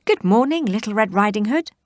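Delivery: high, loud friendly call. Acted. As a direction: call across the woods. Woodcutter calls out.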